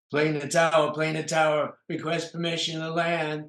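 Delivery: mock radio call to the tower; pilot radio call. Comic repeats the pilot call.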